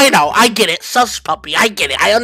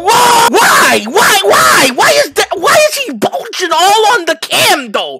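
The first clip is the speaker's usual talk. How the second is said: shocked yell; 9 semitones higher than usual. Real, not acted. Shocked by video.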